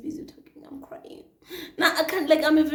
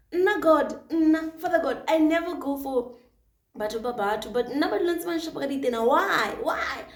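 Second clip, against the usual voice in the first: raised, louder retold plea to God; exasperated plea; 5 semitones higher, 7 dB louder than usual. Woman asks God why in the car, exasperated plea. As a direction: plead upward in mock despair.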